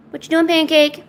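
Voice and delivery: high singsong call to the cat; pet talk. Real, not acted.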